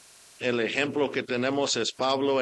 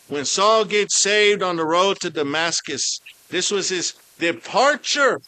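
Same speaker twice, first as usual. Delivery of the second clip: preaching at full force; 5 semitones higher, 7 dB louder than usual. Acted. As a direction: call out like a preacher on fire. Preaching Saul's conversion.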